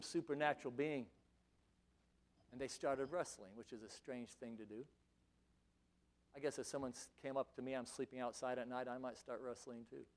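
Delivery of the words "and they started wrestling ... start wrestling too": quiet aside. Real, not acted.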